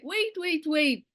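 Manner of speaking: high-pitched imitation of a protesting mother; retold objection, high falling repeats; mock motherly alarm. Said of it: "my mom is like".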